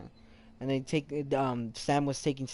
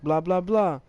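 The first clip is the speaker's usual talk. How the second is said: lightly voiced taunting thug, a bit higher; mock thug voice; 4 semitones higher, 4 dB louder than usual. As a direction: sneer it like a local thug mocking tourists. Voicing the hostile townsfolk.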